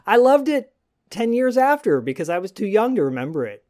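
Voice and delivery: high teasing quote of the cohost; poking fun in a lifted voice; mock quote. Teasing the cohost about his age, mock voice. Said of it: "You're like".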